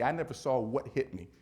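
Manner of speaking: normal talking voice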